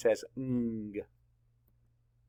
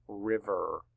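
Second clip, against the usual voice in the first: normal talking voice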